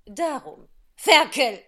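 plötzlich laut herausgeschrien; heftig. Acted. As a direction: das letzte Wort wütend herausschleudern. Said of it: "mit solcher Heftigkeit". (wütend geschrien)

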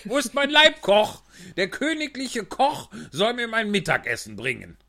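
high, loud, slow royal demands; demanding king. Acted. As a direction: demand lunch like a spoiled king. King demands his lunch.

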